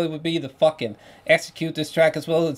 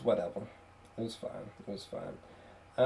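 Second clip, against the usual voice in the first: fading indifference; 7 semitones lower, 14 dB quieter than usual. Real, not acted. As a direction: let it trail off, unbothered. Indifferent verdict.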